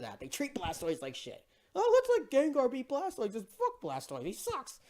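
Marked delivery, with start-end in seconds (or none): 1.8-4.8 higher, dismissive mock voice; mocking impression. Mocking the studio's choice to let Gengar win, sneering. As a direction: sneer it out like a careless studio boss.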